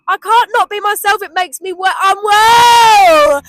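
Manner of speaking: building to a loud high cry; rising to a shout on unwell; emotional outburst. Real, not acted. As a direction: let it burst out.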